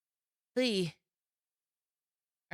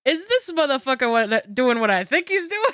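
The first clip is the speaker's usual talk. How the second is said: loud, shocked disbelief; loud, high and incredulous; 7 semitones higher, 10 dB louder than usual. Real, not acted. Disbelief at streamer's act.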